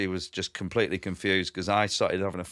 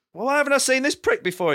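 indignant; 3 dB louder than usual. Retold or imitated. Acted indignant question in quarrel.

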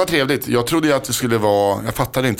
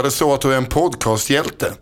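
ordinary talk

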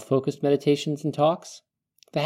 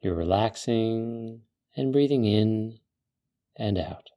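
calm meditative tone; 3 semitones lower than usual. Acted. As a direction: ease the listener into calm. Leads a guided desert meditation.

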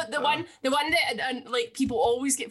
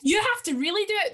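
ordinary talk, nothing marked

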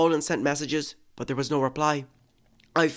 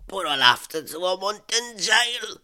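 raised pitch, mildly aggrieved character voice; Kim's protesting voice; 7 semitones higher than usual. Acted. Scammer told there is no more money, protesting with mild anger. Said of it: "His voice sounded angry".

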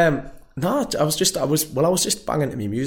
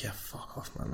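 muttered retort; 2 semitones lower, 18 dB quieter than usual. Retold or imitated. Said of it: "And I was just like".

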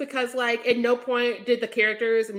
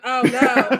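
laughing dismay; 6 dB louder than usual. Retold or imitated. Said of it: "And I'm like".

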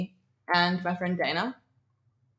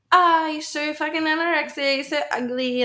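higher, taunting jeer at a passer-by; mock jeering girls; 8 semitones higher than usual. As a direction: toss the insult out like drunk girls heckling. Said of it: "these girls were screaming out".

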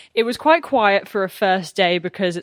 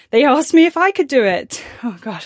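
nervous; 5 semitones higher, 4 dB louder than usual. Real, not acted. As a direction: let the nerves show, then drop your voice. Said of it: "how nervous I am from my voice".

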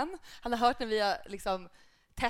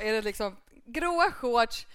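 ordinary talk, nothing marked

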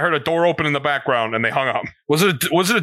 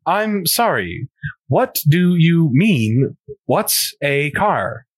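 slow, puzzled phone voice; slow baffled; slower than usual. Pretend. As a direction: drag it out in confusion. Character baffled.

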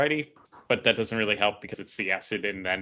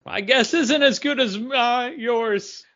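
lifts high and a bit louder to mock the article; 12 semitones higher, 5 dB louder, slower than usual. Co-host mocking the article's line in a squeaky high voice.

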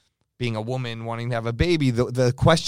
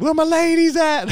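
high excited party shout; party hype call; 4 dB louder than usual. Retold or imitated.

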